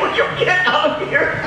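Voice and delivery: high, laughing shoo; teacher shooing a child out, laughing; laughing exasperation. Retold or imitated. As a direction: shoo him out while laughing.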